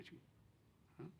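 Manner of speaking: normal talking voice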